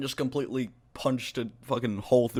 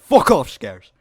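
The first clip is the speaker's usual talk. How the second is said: loud mock outburst in a YouTuber parody; abrupt, loud put-on dismissal; Brad Does Banter impression; 3 semitones higher, 11 dB louder, much slower than usual. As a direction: shout it as the impersonated character. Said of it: "That's my best impression".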